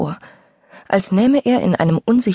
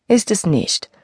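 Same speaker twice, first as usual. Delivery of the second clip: ordinary delivery, nothing marked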